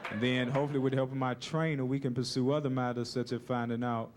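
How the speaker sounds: low, halting loser's interview; dazed boxer giving a post-fight statement; battered boxer. Acted. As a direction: speak low as a fighter just beaten senseless. Acts beaten boxer interviewed after bout.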